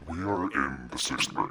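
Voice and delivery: ordinary talk, nothing marked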